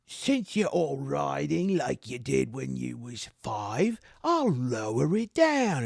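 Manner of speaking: high, slow, drawn-out bluster; scolding knight trainer. Acted. Trainer mocking his trainees' riding as he lowers it, scolding. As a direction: berate them in a high put-on voice.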